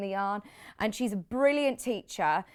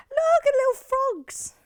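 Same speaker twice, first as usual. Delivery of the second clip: very high, louder squeal of delight; excited squeal; 6 dB louder than usual. Real, not acted. Host spotting little frogs on the fabric, squealing with delight. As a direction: squeal it like something adorable.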